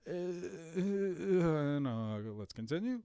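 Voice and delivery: drawn-out stalling uh, then moving on; hesitant mock stammer. Commentator mimics Joe pausing before he continues, mock hesitant. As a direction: imitate someone stalling mid-thought. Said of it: "Joe pauses for a second".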